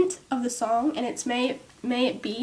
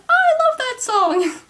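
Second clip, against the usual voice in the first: excited gush; 9 semitones higher than usual. Retold or imitated. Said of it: "I'm like".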